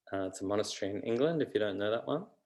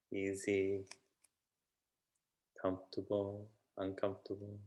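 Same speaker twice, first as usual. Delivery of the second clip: unhurried meditation voice with long pauses; calm, even delivery, phrase by phrase; guided meditation; 3 dB quieter than usual. Real, not acted. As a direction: gently guide them inward, leaving space between phrases.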